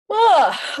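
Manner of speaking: big yawn rising high and loud; yawning out loud mid-sentence; real yawn. Real, not acted. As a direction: let the yawn take over. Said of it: "Oh, I'm gonna yawn".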